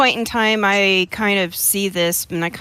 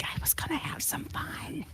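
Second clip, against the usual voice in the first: silent mouthed words, higher, barely voiced; mouthed whisper; 8 semitones higher, 16 dB quieter than usual. Shown as a demonstration. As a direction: mouth the words almost soundlessly to a friend. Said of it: "whisper, ... enunciate them, but not actually say anything out loud".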